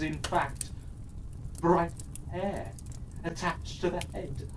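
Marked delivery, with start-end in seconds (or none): none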